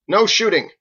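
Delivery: loud, high warning shout; calling out. Acted. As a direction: stop them at once. Andelin calls out a warning.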